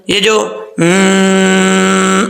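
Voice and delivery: a long nasal hum on م; held ghunna. Shown as a demonstration. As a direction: hold the nasal sound long. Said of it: "یہ آواز آ رہی ہے نا". (غنہ کی لمبی ناک والی آواز)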